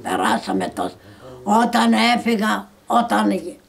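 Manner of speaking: normal talking voice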